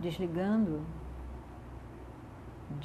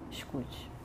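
softly whispered word; 3 semitones lower, 6 dB quieter than usual. Acted. Guided meditation, breathed instruction.

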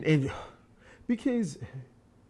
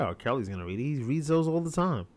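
normal talking voice

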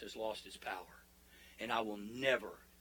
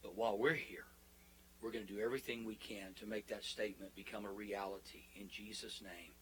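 hushed congregational prayer, easing down to amen; closing prayer; 2 semitones lower, 3 dB quieter than usual. Real, not acted. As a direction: pray earnestly, settling into a quiet close.

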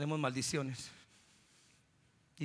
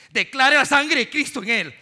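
loud, high, fervent pulpit cry; full-voiced sermon climax; preaching at full force; 12 semitones higher, 16 dB louder than usual. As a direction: call it out over the congregation. Preaching the blood of Christ.

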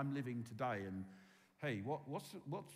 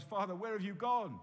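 anguished cry; 6 semitones higher, 7 dB louder than usual. Retold or imitated. Jesus crying out on cross.

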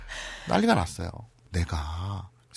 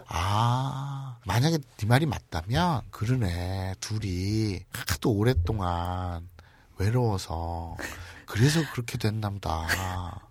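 low, soft, slow sympathy; hushed musing as it sinks in; softly rueful, drawn-out conclusion; pensive realization; 5 semitones lower, 7 dB quieter, slower than usual. Acted. Acting out persuaded, sympathetic listener.

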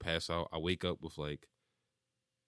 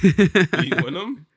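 slow, loud laughing question; 9 semitones higher, 14 dB louder, much slower than usual. Real, not acted. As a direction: tease while laughing.

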